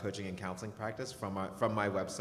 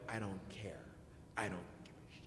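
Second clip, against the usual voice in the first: hushed, low and nearly whispered; mock cold dismissal; 4 semitones lower, 12 dB quieter than usual. Mother retelling her answer to crying son, hushed dismissal. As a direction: drop it right down, dismissively.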